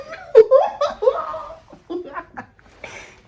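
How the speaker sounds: high, loud laugh out of crying; tearful laugh. Real, not acted. After crying over the story, laughing through tears.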